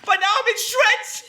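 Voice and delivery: high, strained cry; frayed. Acted. Narrator worn to shreds by both women, high and frantic. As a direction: sound at the end of your rope.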